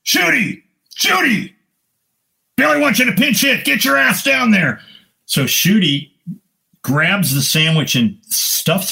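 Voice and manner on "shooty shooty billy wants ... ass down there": yelling. Retold or imitated. Coach yelling up the tunnel at the player. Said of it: "I hear Clete Boyer yelling".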